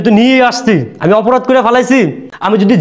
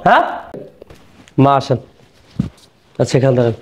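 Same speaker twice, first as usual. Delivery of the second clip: ordinary talk, nothing marked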